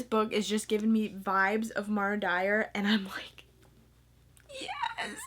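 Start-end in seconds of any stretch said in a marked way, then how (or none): 4.6-5.2 squeaky, very high laughing yes; excited yes. Real, not acted. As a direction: burst out with a giggly yes. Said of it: "I just got really excited".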